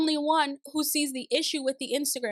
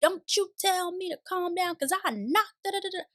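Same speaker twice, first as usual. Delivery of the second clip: high-pitched mock tirade at usual volume; mock yelling; 8 semitones higher than usual. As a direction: imitate an indignant yell, pitched up, tailing into gibberish. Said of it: "And she's still yelling".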